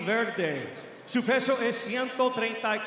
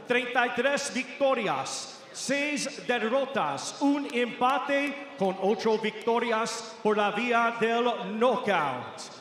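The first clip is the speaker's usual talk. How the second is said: normal talking voice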